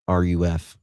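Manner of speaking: ordinary talk, nothing marked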